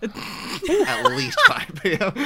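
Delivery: high, slow mocking echo; high mocking repeat. Shown as a demonstration. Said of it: "but you guys were acting like".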